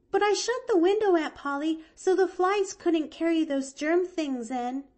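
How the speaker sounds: put-on high, eager little girl; bright child explaining herself; girl's voice. A narrator's reading. Girl explaining to her aunt why she slept outside, high.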